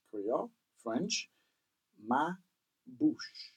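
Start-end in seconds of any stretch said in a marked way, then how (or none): none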